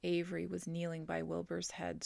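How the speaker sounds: plain reading voice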